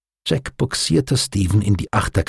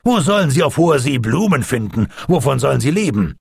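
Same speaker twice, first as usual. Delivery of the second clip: raised, higher incredulous questions; exclaiming; 6 semitones higher, faster than usual. A narrator's reading. Captain protests bees aboard a warship, exclaiming. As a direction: object in mild exasperation. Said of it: "rief Jack".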